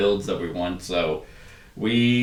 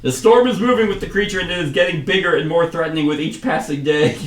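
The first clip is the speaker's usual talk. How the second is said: higher, louder declaiming commander; commander retake; 6 semitones higher, 4 dB louder than usual. Acted. Commander warning of the growing storm, high loud declaiming. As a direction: restart the speech high and emphatic. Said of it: "I can't keep a consistent accent".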